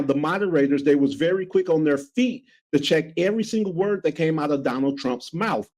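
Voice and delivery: ordinary talk, nothing marked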